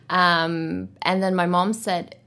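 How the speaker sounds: ordinary delivery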